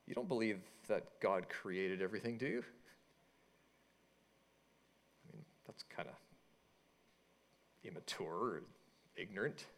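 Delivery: low, soft, patronizing mock-professor tone; imitating condescending professor. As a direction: quietly belittle the student, trailing into a murmur. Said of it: "some of those teachers or professors".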